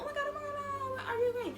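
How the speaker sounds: high, fast panicked fussing; frantic crowd voices. Retold or imitated. Crowd fussing over Blubber.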